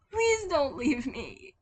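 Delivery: high, slow pleading; a scared child begging not to be left; slow high begging plea; begged. Acted. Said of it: "he begged".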